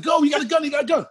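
high-pitched, frantic escape cry; panicked yelling. Retold or imitated. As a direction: yell urgently to get the driver moving. Repeats the panicked getaway cry.

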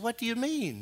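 high, softened questioning; lightly voiced surprise, high; puzzled church mother.

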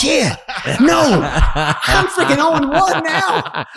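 high laughing disbelief; laughing exasperation. Retold or imitated. Retold exasperated reply, laughing.

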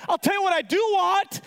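high, loud mock outburst; indignant. Lender answering his debtor's dessert offer, indignant.

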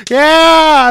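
put-on high, loud drunken bar whoop; crowd cheer.

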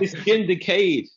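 high, loud mock plea; mock excuse. Pretend shooter justifying himself, high and loud. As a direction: whine it out high and slow.

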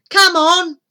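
loud high urging; urgent call. Acted. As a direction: hurry them up. Urgent departure.

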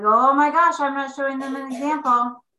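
loud, high mock alarm at herself; mock panicked inner voice. Retelling her guilt at the family table, mock alarmed. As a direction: replay the old self-judging panic. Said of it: "I had a lot of mind drama".

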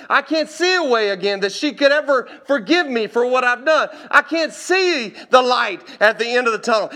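loud, high-pitched preacher retelling a testimony; impassioned preaching. Retold or imitated. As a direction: preach it at full force, voice lifted high.

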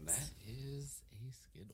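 slow unvoiced whisper. Real, not acted. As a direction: whisper it behind a hand. Aside whispering the answer.

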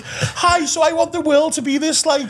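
put-on high, chirpy pageant-girl voice; sing-song like a beauty queen; mock pageant contestant. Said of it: "have to go on and say".

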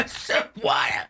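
high splutter, voiceless choked words; gasping after a bad swallow; choking on drink. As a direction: splutter and cough on strong liquor.

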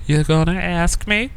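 high, slow wheedling imitation; teasing girlfriend nag. Girlfriend comes round the corner, teasing proposal nag.

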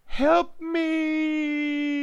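high loud cry for help; scream. A narrator's reading. Strapped to a table, the narrator screaming for help. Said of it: "I screamed".

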